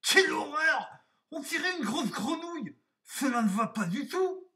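very high, loud, horrified squawk; put-on high wolf voice, indignant; exclaiming wolf. A narrator's reading. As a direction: shriek in horror at your reflection. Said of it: "et s'exclama".

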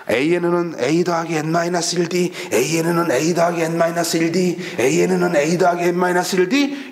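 뜻 없이 줄줄 외는 단조로운 반복; 공식을 주문처럼 외우는 학생 흉내; 기계적 암기. Retold or imitated. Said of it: "이렇게 외워서". (기계적으로 달달 외우듯)

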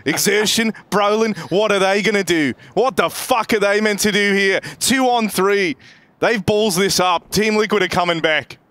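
live esports play-by-play. Real, not acted. Caster follows the double kill for viewers, lively play-by-play. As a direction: call the round with rising excitement.

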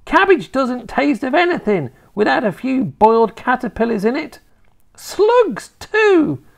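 high, loud grandma voice; gleefully nasty grandma. Acted. Grandma pushing caterpillars on her grandson, relishing.

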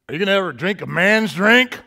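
loud, mocking dare; taunting mom. Girlfriend's mom at bar, loud teasing taunt. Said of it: "And she goes".

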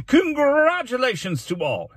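high, loud, slow ceremonial flourish; pompous mayor. Acted. Mayor congratulating the class, pompous proclamation. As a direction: proclaim it grandly, stretching the words.

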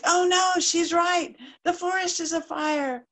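high, loud dismayed cry; starling shocked to see the smoke; alarmed realisation. Acted. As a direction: gasp it out in alarm.

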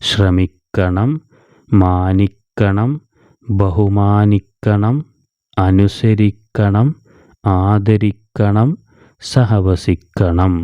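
each word stretched slowly with a listing rise; slow recap list. Shown as a demonstration. Teacher slowly reads back all six joined words for pupils. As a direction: read the list slowly so they can follow. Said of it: "reviewing the joined words".

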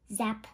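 a low voiced zap noise; zap sound. Child zapping the map, a low zap noise. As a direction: drop deep for a quick zap.

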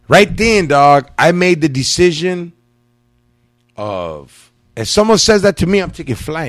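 loud and high, hyped up; excited loud declaration. Real, not acted. To his cohost, hyped and loud about taking flight. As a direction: belt it out like a big vow. Emphatic punchline about fleeing.

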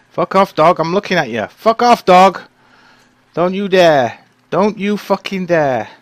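loud high threatening yell; angry shout. Real, not acted. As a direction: shout the wolf down. Shouting down the wolf.

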